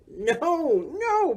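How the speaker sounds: mild storybook roar, high and drawn-out. A narrator's reading. Boris contradicting Morris about Halloween, mock roar. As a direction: roar it in character without much volume. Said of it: "Boris roared".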